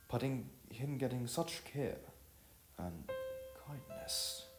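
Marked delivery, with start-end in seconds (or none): none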